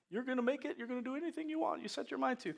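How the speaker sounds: high, soft cheerleading voice; mock pep talk. Preacher parodies a happy motivational speech, high mock pep talk. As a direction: chirp it lightly like empty encouragement.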